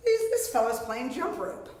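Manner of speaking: ordinary delivery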